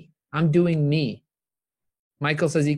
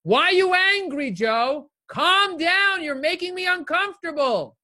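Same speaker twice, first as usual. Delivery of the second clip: much higher, strained voice of an uneasy critic; mocking other people; 14 semitones higher, 3 dB louder, slower than usual. Imitating people telling him to calm down, high and mocking. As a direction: squeal it like someone put off by his passion. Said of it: "people will say".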